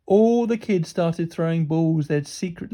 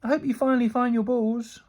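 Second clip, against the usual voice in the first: raised, teasing call; mock-sincere parting shot, pitched up; 6 semitones higher than usual. A narrator's reading. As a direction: call it back cheekily. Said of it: "called out Rebel".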